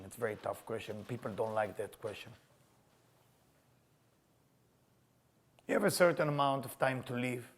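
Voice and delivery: hushed earnest sermon; quiet sincere. Acted. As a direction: lean in and ask gently.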